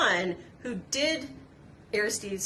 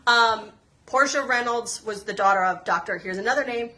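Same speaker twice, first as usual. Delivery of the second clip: ordinary talk, nothing marked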